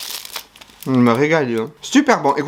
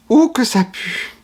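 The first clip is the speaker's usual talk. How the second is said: disgusted; 8 semitones higher, slower than usual. Real, not acted. Smelling the opened sprats.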